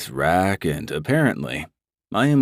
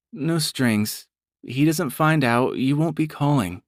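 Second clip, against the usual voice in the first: a hint of a higher nervous woman; lightly voiced woman; 8 semitones higher than usual. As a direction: say it as a hesitant client. Brandy speaking.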